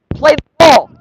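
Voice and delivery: furious high yell at the players; angry shout. Real, not acted. Streamer shouting at his team to play the ball. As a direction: scream the order at the pitch. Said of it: "I'm getting mad".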